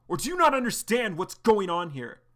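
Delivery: John berating Arlo for serving his mentor's killer; angry demand. Acted. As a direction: push him for an answer.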